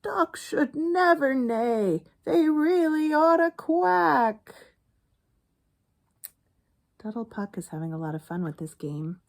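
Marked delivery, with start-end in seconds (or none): 0.0-4.6 high, loud, slow stunned horse; mildly stunned horse voice. Acted. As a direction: lecture like a shocked horse. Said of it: "Hank the horse was stunned".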